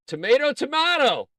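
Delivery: loud, high, singsong announcement; playful shout. Pretend. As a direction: belt out both tomatoes high. Announces the pick with gusto.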